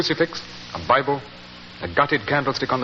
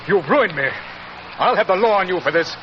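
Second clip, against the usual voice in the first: furious accusation; 5 semitones higher than usual. Acted. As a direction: hurl the accusations at him. Ruined lord confronts the villain.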